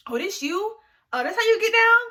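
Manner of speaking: high, loud mocking voice; teasing classmates. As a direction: tease her like a gossiping classmate. Classmates mocking her video.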